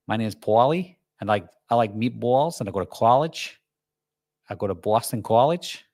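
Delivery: put-on New Jersey wiseguy with stretched "aw" vowels; [name]'s mock New Jersey accent. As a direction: ham up a New Jersey accent, lines lifting at the end. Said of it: "me doing a New Jersey accent".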